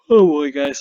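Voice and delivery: words stretched through a big yawn; tired yawn mid-sentence. Real, not acted. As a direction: yawn it out loud and long. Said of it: "I'm sorry, I'm yawning".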